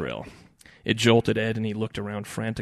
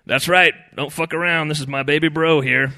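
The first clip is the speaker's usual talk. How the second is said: loud, raised, bragging; proud boasting; 6 semitones higher, 10 dB louder than usual. A narrator's reading. Older brother claims Ed as his own, boastful. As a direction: crow it for all to hear.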